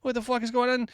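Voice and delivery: high-pitched retelling of a bewildered protest; mock-distressed high voice; retold protest. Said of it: "I was like".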